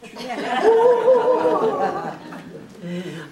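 loud high laugh; long hearty laughter; real laugh. Real, not acted. Joking about real ages.

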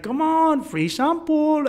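high, drawn-out tout impression; Colombian street hustler. Hustler pushing a free sample, high imitated voice. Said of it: "And he's like".

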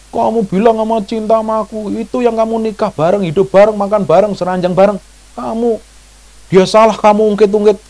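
high-pitched acted grievance; imitated nagging reproach. Wife dredging up the past to her spouse, high imitated nagging. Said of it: "Kata orang Jawa, diundat-undat".

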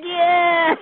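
high, delighted yeah; excited cheer. Retold or imitated. Caller recalls her thrill at the pastor's words, excited. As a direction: cheer it, on the edge of laughter. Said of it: "Oh, I got excited".

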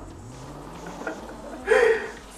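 very high squealing laugh; shrieking laugh. Real, not acted. Gamer after a crash, very high squealing laugh. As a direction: let out a high squealing cackle.

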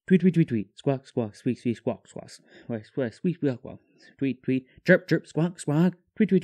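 deadpan spoken tweets and squawks; bird imitation. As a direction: talk to the birds in their own language. Imitating bird calls to talk.

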